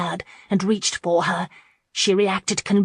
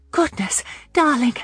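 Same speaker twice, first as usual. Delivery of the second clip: startled, higher gasp of surprise; breathless surprise; 7 semitones higher than usual. A narrator's reading. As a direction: exclaim breathlessly.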